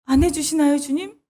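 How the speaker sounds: high, pleading whimper to God; whining prayer. Acted. Worshipper pleading with an unanswering Lord, high whimper. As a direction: beg like a hurt child who gets no answer. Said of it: "이런 마음".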